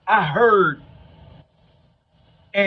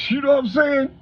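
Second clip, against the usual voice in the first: laughing; 12 semitones higher than usual. Real, not acted. Laughing after mother's remark.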